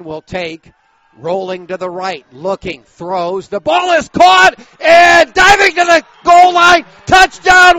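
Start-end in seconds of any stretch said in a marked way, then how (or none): 3.5-7.8 roaring touchdown call, much higher and louder; touchdown shout. Real, not acted. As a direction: yell it out as the winning score goes in. Touchdown call in live play-by-play.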